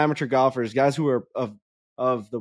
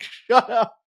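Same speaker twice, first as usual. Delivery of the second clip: higher, firm dog-scolding shut up; 8 semitones higher than usual. Retold or imitated. Silencing a screaming fan like a dog, mock command. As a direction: command it like you're training a dog. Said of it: "it was like talking to a dog".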